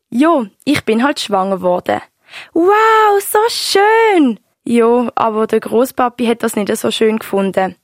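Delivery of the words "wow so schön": much higher, loud cry of delight. Real, not acted. Grandchild thrilled to hear grandma was pregnant, delighted. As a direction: gush with joy.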